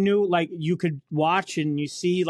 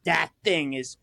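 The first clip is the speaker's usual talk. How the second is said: usual speaking voice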